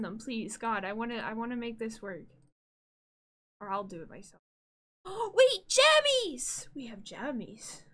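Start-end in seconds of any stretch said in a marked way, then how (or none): 5.0-6.7 gasping squeal, much higher and louder; thrilled discovery shout; delighted gasp, then high squeal; excited gasp. Real, not acted. Excited gasp at new emotes.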